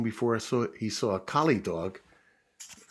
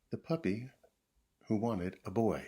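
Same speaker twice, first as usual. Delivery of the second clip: ordinary delivery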